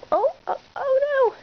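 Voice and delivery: high playful oh no; mock alarm. Owner playing with puppy, mock high alarm. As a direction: squeal in pretend alarm at the puppy.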